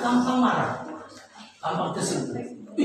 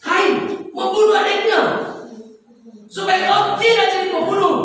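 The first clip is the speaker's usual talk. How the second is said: raised, ringing proclamation; preaching at full force; 7 semitones higher, 5 dB louder than usual. Acted. Preacher proclaims healing to the congregation, ringing and raised. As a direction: call it out over the congregation. Preacher building to sung climax.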